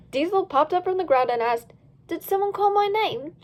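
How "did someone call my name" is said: character voice. Acted. Diesel popping up, character voice.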